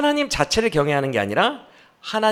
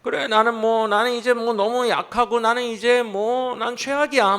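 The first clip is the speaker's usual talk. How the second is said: a mild mock whine, voice lifted; sulking self-pity, lightly voiced; whining complaint; 4 semitones higher than usual. Preacher voicing Job's grumbling, a mild mock whine. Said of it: "푸념 털어놓던".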